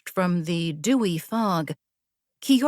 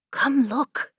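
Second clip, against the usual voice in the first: urging; 7 semitones higher than usual. A narrator's reading. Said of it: "Kiora urged".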